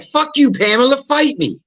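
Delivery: high, mock combative mom; imitating a rude mom. Mom nowadays cursing out the neighbor, combative. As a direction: pick a fight with the neighbor.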